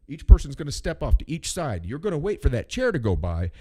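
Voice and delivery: loud, clear instructions to a crowd; loudly voiced lift attendant instructions; lift operator spiel. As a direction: announce it like a ski lift attendant. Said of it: "I was like".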